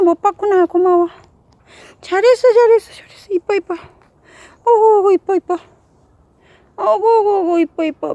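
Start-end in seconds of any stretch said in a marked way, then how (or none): 2.0-8.1 loud, gushing praise for a pet; shower the dog with praise; talking to a dog. Real, not acted. Praising dog after it sits.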